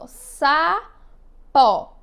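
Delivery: "Sapó" split into sa, then a loud pó; syllable by syllable. Shown as a demonstration. Teacher counting mouth openings, "Sapó" split syllable by syllable. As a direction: say "Sapó" in two long, separate beats. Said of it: "Quantas vezes eu abri minha boca?".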